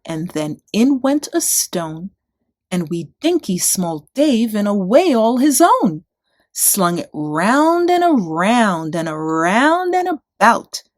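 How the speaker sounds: ordinary delivery, nothing marked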